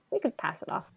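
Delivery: ordinary delivery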